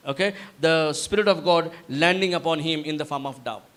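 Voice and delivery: ordinary delivery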